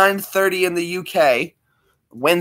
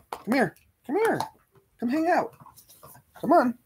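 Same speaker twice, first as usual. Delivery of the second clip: coaxing pet voice; 11 semitones higher, 7 dB quieter than usual. Real, not acted. Coaxing the dog over.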